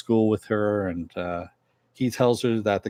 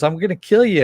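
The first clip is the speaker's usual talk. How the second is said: high, loud retold threat; mock threat; 9 semitones higher, 7 dB louder than usual. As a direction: punch out the villain's threat, raised pitch. Villain threatens to kill her.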